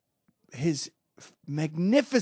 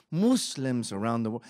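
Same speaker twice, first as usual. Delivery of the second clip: normal talking voice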